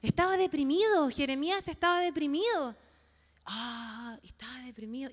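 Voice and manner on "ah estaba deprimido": suspiro desanimado, voz baja y apagada; abatimiento imitado. Retold or imitated. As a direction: act out a soft, downcast sigh. Sigh while voicing the prophet's dejection. (suspiro de desánimo imitado, en voz baja)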